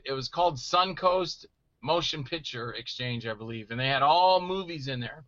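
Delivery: usual speaking voice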